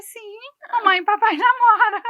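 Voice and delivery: amused mock-announcement with a laugh; laughing retold answer. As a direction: answer the child through a laugh. Mother's answer acted out, laughing.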